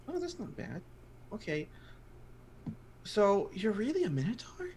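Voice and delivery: put-on high, soft child voice, ending in a whisper; awed gnome kid. Healed gnome kid asks the minotaur, high and hushed. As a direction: kid marvelling quietly.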